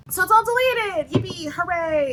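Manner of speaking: high, loud, fake celebration; sing-song hooray that won't last; mock cheering. Reader pretends the video problem is over, mock cheering.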